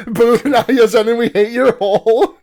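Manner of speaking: loud, high playful booing; mock jeering. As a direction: jeer the villain like a heckling crowd. Joking crowd-style jeer at villain.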